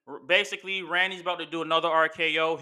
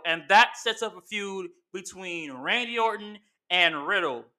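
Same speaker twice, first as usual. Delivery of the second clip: usual speaking voice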